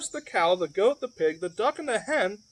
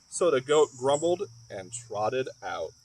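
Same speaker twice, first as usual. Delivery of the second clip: ordinary delivery, nothing marked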